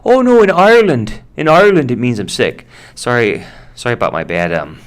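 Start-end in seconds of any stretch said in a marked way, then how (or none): 0.0-2.7 high, loud bad Irish accent protesting; Irish impression. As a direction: do a hammed-up Irish coworker insisting. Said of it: "bad Irish impression".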